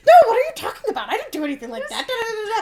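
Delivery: high, loud, fast denial through laughter; mom denying. Retold or imitated. Mom denying to her daughters, high laughing protest. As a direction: protest too much, laughing. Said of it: "She's like".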